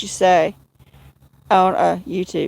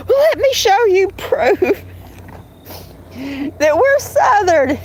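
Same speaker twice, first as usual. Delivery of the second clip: higher mock voice of John, laughing through it; imitating John; 8 semitones higher than usual. Mocking John's insistence he's Southern, higher laughing voice. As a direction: mimic him defensively proving a point, giggling. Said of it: "you're like".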